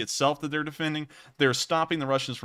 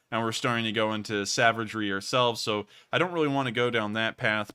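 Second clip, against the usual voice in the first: usual speaking voice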